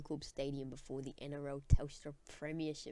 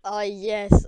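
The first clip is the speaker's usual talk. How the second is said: excited; 5 semitones higher, 18 dB louder than usual. Real, not acted. Reacting to the resignation news.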